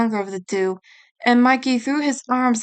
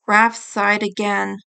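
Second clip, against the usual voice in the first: usual speaking voice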